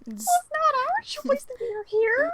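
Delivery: very high, small put-on voice; squeaky timid plea. Scared friend excusing trespass to Lucifer, squeaky timid plea. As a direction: plead in a tiny squeak.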